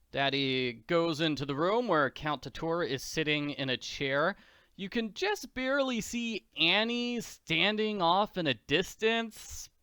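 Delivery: ordinary delivery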